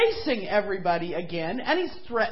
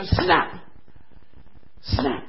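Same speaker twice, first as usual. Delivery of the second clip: crocodile snap; 4 semitones lower, 4 dB louder than usual. Acted. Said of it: "he'd go".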